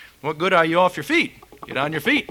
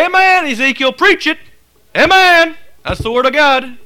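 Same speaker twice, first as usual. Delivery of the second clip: high, loud amen-shouting; mock congregation cheer, pitched way up; 11 semitones higher, 11 dB louder than usual. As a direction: shout approval like a fired-up church member. Acting out an approving congregation.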